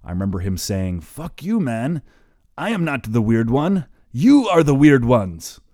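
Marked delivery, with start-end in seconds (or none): 1.0-5.6 higher, louder mock German protest; a teasing German accent, indignant; funny German accent. Retelling his German friend's protest in German accent. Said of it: "in his funny German accent".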